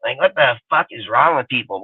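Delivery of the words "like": ordinary talk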